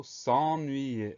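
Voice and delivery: S'ENNUYER drawn out as s'en-nu-yer; syllable by syllable. Shown as a demonstration. Teacher introduces the next dictation word, syllable by syllable. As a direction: say s'ennuyer slowly, stretching each syllable.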